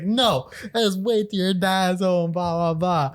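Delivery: high, loud, slow warning from mom; threatening mom. Retold or imitated. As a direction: scold like a mother promising dad's punishment. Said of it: "and she's like".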